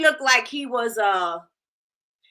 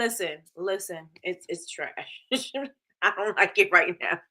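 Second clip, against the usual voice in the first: ordinary talk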